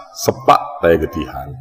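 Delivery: ordinary delivery, nothing marked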